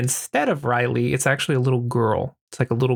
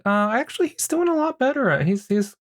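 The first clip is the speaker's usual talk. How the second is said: high-pitched; 11 semitones higher than usual. Retold or imitated. Jade answers Mia hesitantly.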